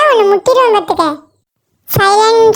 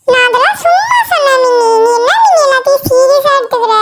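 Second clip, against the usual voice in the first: higher-pitched placating plea; nervous backpedalling; 3 semitones higher than usual. Acted. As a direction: wriggle out of a fight, voice raised in pitch. Backing down from the fight. (பயந்து சமாளிக்கும் கெஞ்சல்)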